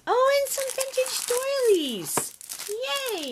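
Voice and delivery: very high squeal of glee; delighted squeal. Real, not acted. Crafter unpacks vintage doilies, squealing with delight. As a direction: squeal it, thrilled by the find. Thrilled at finding more gifts.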